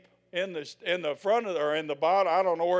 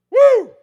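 excited whoop; 19 dB louder than usual. Real, not acted. Preacher exclaims after proclaiming.

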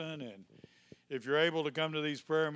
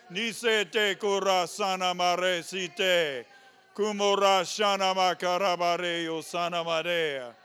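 fervent rolling tongues, louder; speaking in tongues; 2 semitones higher, 7 dB louder than usual. Real, not acted. Pastor praying in tongues over the congregation, fervent. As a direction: pour out tongues in worship.

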